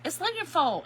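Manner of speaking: loud, high Kentucky "loonyun fole"; Kentucky accent. Shown as a demonstration. Crafter insisting on her word to viewers, loud Kentucky accent. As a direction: belt out "aluminum foil" Kentucky-style. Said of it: "that is the Kentucky".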